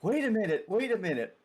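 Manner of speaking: high, rising-and-falling plea to hold on; urgent interjection. Acted. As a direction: cry out to halt someone mid-swing. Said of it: "as you're saying".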